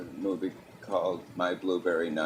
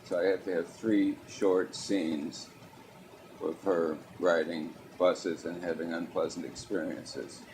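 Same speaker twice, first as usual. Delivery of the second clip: ordinary delivery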